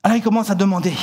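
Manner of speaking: ordinary delivery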